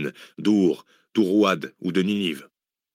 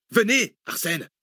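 high, pressing call; urgent summons; 10 semitones higher, slower than usual. A narrator's reading. Zach summons the dazed professor, urgent call. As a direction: summon him urgently, pitch well up. Urging companion to flee.

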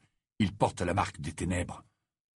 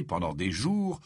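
plain reading voice

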